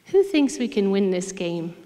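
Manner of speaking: lightly imitated English-accented coach. Coach challenging losing team at halftime, light English accent. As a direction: put on a touch of an English accent, firm and projected. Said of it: "in his English accent".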